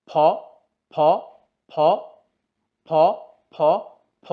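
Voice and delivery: ফ with lips pressed and a puff of air; F sound demo. Shown as a demonstration. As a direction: push air out through closed lips on ফ. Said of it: "আমি ফি এর উচ্চারণ করছি". (ঠোঁট চেপে বাতাস ছেড়ে ফ উচ্চারণ)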